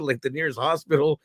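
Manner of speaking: ordinary delivery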